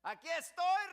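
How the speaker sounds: loud, high shouted answer; calling back. Acted. Daniel answering the king from the lions' pit, calling out.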